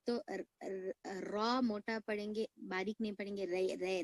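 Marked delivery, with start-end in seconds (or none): none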